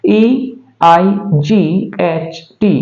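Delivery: slow letter-by-letter spelling; E I जी H T said one letter at a time, slowly; spelled out slowly. Shown as a demonstration. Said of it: "उसी तरह से".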